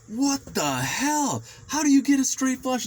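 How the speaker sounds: high, slow, incredulous what the hell; replaying the shock of losing big; disbelieving outburst. Retold card game outburst.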